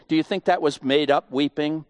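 usual speaking voice

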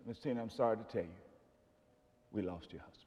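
low, soft and quick, fading to almost nothing; hushed bad news. Doctors quietly telling a widow her husband died, hushed. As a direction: break the news softly, trailing away.

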